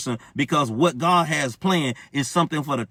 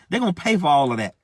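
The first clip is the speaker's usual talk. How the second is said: normal talking voice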